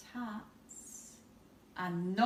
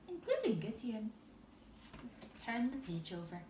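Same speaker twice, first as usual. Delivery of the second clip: plain reading voice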